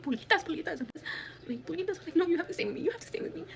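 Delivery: high, tearful pleading; desperate plea to a hurt pet; retold bawling. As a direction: beg in a high, upset voice. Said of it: "like, bawling".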